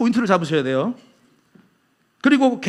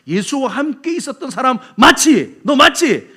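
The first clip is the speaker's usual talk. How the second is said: loud, higher pointed questioning; accusing challenge; 5 semitones higher, 5 dB louder, slower than usual. Acted. Servant girl confronting Peter by the fire, accusing. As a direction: press them as if catching them out.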